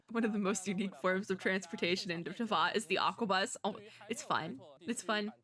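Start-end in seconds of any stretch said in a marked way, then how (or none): none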